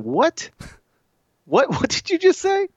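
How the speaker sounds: high-pitched, incredulous retort, a laugh breaking in; mock parental disbelief. Dad retells challenging his cussing son, mock shocked. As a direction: imitate a taken-aback dad, voice jumping up.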